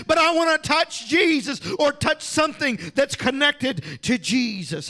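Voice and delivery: high, driving sermon delivery; full-force preaching. Real, not acted. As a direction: preach it with rising urgency.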